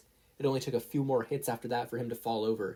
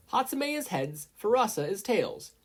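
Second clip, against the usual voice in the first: high referee call; lightly voiced referee; 11 semitones higher, 4 dB louder than usual. A narrator's reading. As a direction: Midnight calls the coin toss, raised and high.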